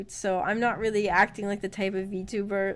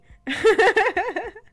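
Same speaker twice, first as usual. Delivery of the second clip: high, loud laugh; loud burst of laughter; 11 semitones higher, 7 dB louder than usual. Real, not acted. Big laugh after being mocked.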